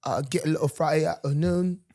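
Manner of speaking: put-on London accent, conversational. Guest laying out the week, mock London accent. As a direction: keep up the pretend London accent. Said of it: "Is that a London accent".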